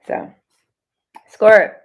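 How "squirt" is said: louder call of "Squirt"; loud nickname call. Real, not acted. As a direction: call out the nickname to grab attention. Calling out to the viewer.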